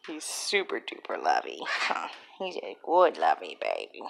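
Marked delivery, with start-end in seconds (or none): none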